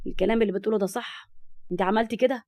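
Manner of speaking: plain reading voice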